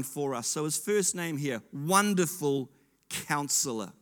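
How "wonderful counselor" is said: normal talking voice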